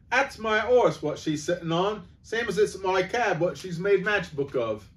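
cabby complaining to the policeman; aggrieved cockney cabby. Acted. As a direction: stake your claim loudly.

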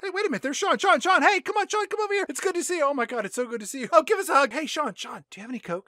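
high, eager greeting voice; excited big brother. Retold or imitated. Brother spots Sean and rushes to hug him, high and eager. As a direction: call out gleefully to a long-lost brother.

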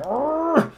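high honking squawk; angry goose honk. Retold or imitated. Pilot mimics a prop honking angrily. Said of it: "Getting all angry".

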